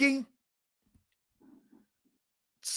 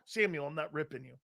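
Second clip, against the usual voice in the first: usual speaking voice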